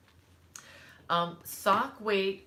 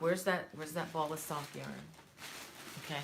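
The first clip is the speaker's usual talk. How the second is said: chesty, rattly throat; 6 dB quieter than usual. Real, not acted. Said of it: "Sorry for the rattly, rattly".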